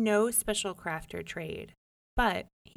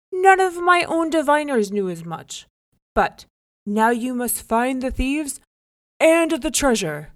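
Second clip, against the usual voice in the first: higher, loud royal command; demanding king; 6 semitones higher, 13 dB louder than usual. A narrator's reading. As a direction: lay the task on him. King orders.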